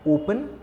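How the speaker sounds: ओपन, its first vowel held up as an example; opening O sound. Shown as a demonstration. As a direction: stress the long opening O of ओपन. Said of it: "different sound produce कर रहे हैं".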